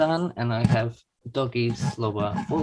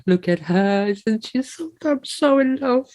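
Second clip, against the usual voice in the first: gushing; 10 semitones higher than usual. Real, not acted. Gushing over new puppy.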